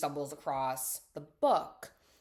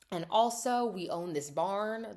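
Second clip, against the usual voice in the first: ordinary talk, nothing marked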